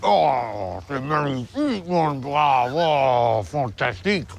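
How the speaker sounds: slow, hammed-up French chef accent. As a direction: savour each word like a cartoon French cook. Tastes French food, hams French.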